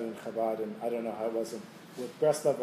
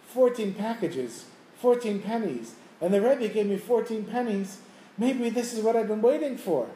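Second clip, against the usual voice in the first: high, building excitement as it clicks; dawning realization; 7 semitones higher, 3 dB louder than usual. Retold or imitated. Man says to himself as the pennies match, dawning excitement. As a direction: piece it together aloud, pitched well up.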